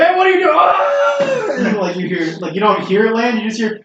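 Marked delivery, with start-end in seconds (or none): 0.0-0.5 high, loud startled shout; talking glove yelling as it's tossed down, loud and high. Acted. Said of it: "He's like". 0.6-1.8 high shrill falling scream. Acted. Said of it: "He's like".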